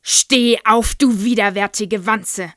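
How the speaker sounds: loud, raging insult; furious shout. A narrator's reading. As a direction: yell down at the child. Headmistress berates pupil.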